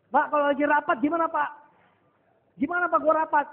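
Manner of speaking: loud, high-pitched protesting question; agitated objection. Acted. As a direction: raise your voice in a worried challenge. Acts out an agitated participant's objection.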